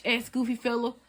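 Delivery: usual speaking voice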